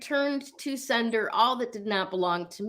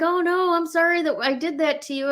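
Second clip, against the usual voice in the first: higher, quick, lightly voiced daughter apologizing; a hint of the daughter, saying sorry fast; lightly imitated daughter saying sorry; daughter's apology; 8 semitones higher than usual. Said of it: "And she's like".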